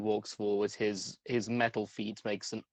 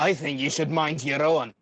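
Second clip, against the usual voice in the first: gruff put-down, a bit louder; rude retort; 5 semitones higher, 5 dB louder than usual. Acted. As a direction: brush her off rudely.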